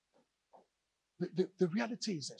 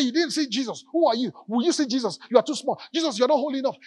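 mocking crowd; 6 semitones higher, 9 dB louder than usual. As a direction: talk down to a child, laughing at her. Group mocks the girl.

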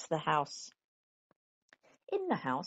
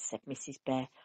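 ordinary delivery

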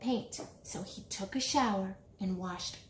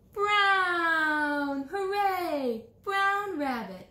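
excited reveal; 6 semitones higher, 3 dB louder, much slower than usual. Pretend. Reveals the final colour.

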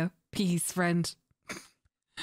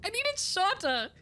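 ordinary delivery

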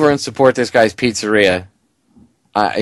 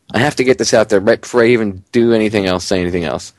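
ordinary talk

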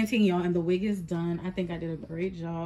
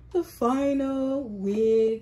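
higher, louder, slow showing off the final wig; presenting the wig; proud reveal; 6 semitones higher, 6 dB louder, much slower than usual. Real, not acted. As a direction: drag it out like an unveiling.

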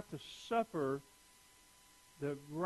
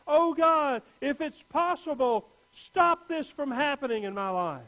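higher, louder pleading cry to God; desperate prayer; 8 semitones higher, 8 dB louder than usual. Pretend. Pastor voicing the believer's plea, desperate cry. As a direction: beg God to spare you.

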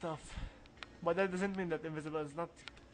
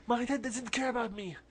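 higher, emphatic retake stressing dad; with feeling; 5 semitones higher than usual. Shown as a demonstration. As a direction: redo it with emotion, leaning on dad. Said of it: "I need to take this with the accent".

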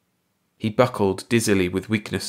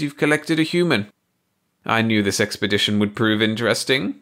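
mocking alien voice; 5 semitones higher, 3 dB louder than usual. A narrator's reading. Baldy mocks his companion.

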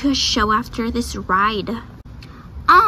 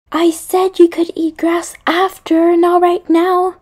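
loud, higher telling-off; exasperated scolding; 4 semitones higher, 6 dB louder than usual. Acted. Correcting horse about eating grass, loud exasperated scolding. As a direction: scold the horse, leaning on "not right now".

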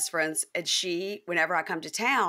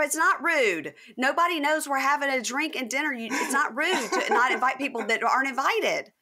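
higher, emphatic reasoning, laughing near the end; insistent; 7 semitones higher, 3 dB louder than usual. Retold or imitated. Insisting to Mary Preston it isn't rude, high and emphatic. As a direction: argue your point with amused conviction.

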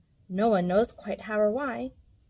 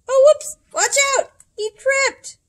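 very high, loud startled cries; sudden squeal of alarm; alarmed exclaim; 11 dB louder than usual. A narrator's reading. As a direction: yelp it as he topples. Dinosaur trips, narrator exclaims.

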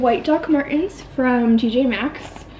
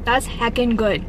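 ordinary delivery

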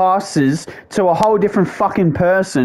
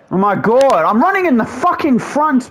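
exasperated rage; 7 semitones higher than usual. Real, not acted. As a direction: pitch climbing high in frustration. Rages at his player's movement.

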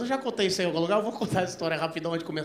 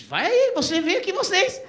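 high, louder, pushing back; pitched up insisting; 10 semitones higher, 7 dB louder than usual. Retold or imitated. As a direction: insist on it loudly. Retold insisting on the phone. (insistindo, voz aguda)

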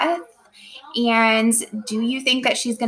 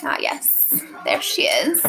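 teacher putting on her costume, hushed; getting into character. Pretend. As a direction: slip into the queen with a hush. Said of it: "let me get back into character".

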